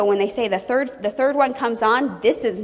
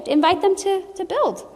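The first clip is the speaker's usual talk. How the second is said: ordinary delivery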